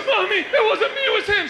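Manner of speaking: put-on high, loud little-boy protest; panicked child's wail, hammed up; pleading child. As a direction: imitate a kid blaming someone else to mom. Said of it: "He goes".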